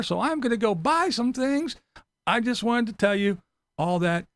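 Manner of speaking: mock-formal shopper voice; mock polite shopper. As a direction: recite it like a pompous legal notice. Acting a silly shopper's disclaimer.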